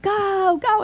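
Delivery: high, loud sideline cheer; excited grandma urging a little player on; loud cheering Nana. Retold or imitated. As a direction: whoop it like a proud grandma at a match. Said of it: "you're the loud Nana".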